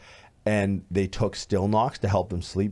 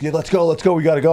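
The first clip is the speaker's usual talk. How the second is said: urgent hurry; 8 semitones higher, 7 dB louder, much faster than usual. Retold or imitated. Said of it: "And she's like".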